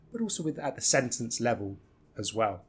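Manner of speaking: ordinary delivery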